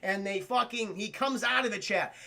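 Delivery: normal talking voice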